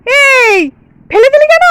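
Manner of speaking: loud, high-pitched protest; shocked scolding. Acted. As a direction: cry out at the child in disbelief. Child threw the chocolate away.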